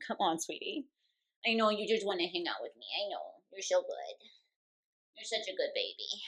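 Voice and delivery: soft, quiet pet talk; cooing to cat. Real, not acted. Owner sets her purring cat down, soft cooing.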